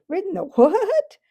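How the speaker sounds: high, loud scoffing retort; contemptuous retort. Acted. Horse scoffing at the boy's donkey riding, contemptuous. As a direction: scoff at the very idea, as a proud horse. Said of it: "retorted the horse with extreme contempt".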